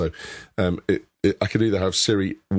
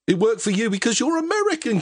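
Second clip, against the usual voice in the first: very high-pitched, exasperated comeback; exasperated protest. Real, not acted. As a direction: protest at the co-host in a squeaky pitch. Mock protest to co-host about accent.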